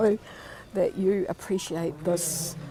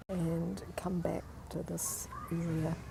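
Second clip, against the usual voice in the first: whispering; 3 semitones lower, 8 dB quieter, slower than usual. Real, not acted. Hushed during the ceremony.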